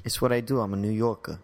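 New York accent, dropped final R. Shown as a demonstration. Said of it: "you just did New York".

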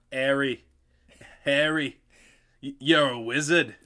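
mock Boston accent, dropped R in wizard. Shown as a demonstration. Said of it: "how to do a Boston accent".

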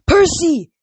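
high, loud cry of a name; urgent call. A narrator's reading. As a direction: shout to your friend across a distance. Rachel calls out to warn Percy.

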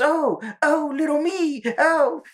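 high, squeaky mock moans. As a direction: moan it in a high cartoon squeak. Cartman voiced moaning.

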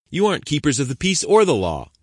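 defiant shout. Acted. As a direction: throw the accusation in their faces. Protester confronting officers.

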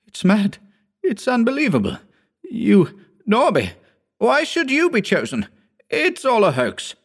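put-on agitated young assistant. A narrator's reading. Hugh's agitated lines.

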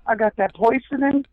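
high-pitched comic hustler voice; tag-along seller. Acted. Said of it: "two of the characters are just guys who stand".

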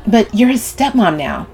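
heated reproach; voice rising. A narrator's reading. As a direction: press the point with rising voice. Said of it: "her voice rising".

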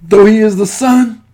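ordinary talk

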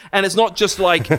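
usual speaking voice

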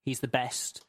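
normal talking voice

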